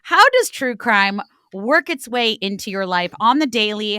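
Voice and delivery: ordinary delivery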